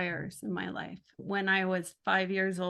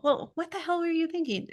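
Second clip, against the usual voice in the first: higher, curious asking, not scolding; an honest adult-to-adult question; genuine question to an adult; 9 semitones higher, faster than usual. Retold or imitated. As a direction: ask it like you really want to know. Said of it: "to the adult".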